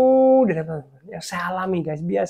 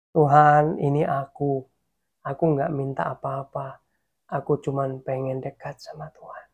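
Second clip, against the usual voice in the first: low, hushed voice dropping toward a whisper; soft intimate prayer; 6 semitones lower, 6 dB quieter than usual. Shown as a demonstration. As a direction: murmur it close and gently to God. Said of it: "ngomongnya lirih, ngomongnya pelan".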